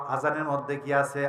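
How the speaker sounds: normal talking voice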